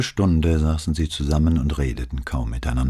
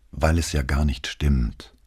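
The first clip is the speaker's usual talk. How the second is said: usual speaking voice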